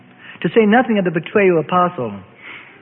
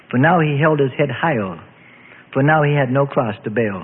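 ordinary delivery

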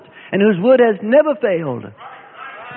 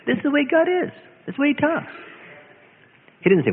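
normal talking voice

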